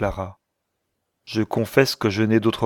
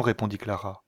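usual speaking voice

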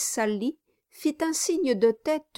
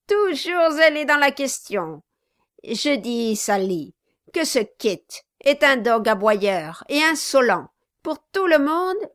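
louder, higher spiteful tirade; Quilp the dwarf, venomous; 5 semitones higher, 5 dB louder than usual. Acted. Quilp denouncing Kit to the Brasses, louder spite. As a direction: pile the insults on with contempt.

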